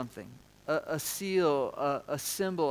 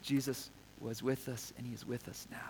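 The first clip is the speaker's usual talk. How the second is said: soft recollection; 5 semitones lower, 11 dB quieter than usual. Retold or imitated. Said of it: "were reminded".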